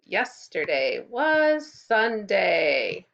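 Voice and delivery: slow, loud, stretched teacher call; drawn out and loud for the class to echo. Shown as a demonstration. Teacher calls the day for kids to repeat, slow and loud. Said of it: "Can you repeat after me?".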